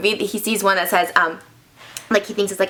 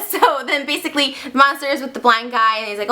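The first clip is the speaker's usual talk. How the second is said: usual speaking voice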